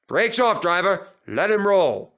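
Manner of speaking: high and loud barked order; commanding inspector. Retold or imitated. Culdee voicing the inspector ordering the brakes off, loud commanding call. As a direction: command the driver to let go.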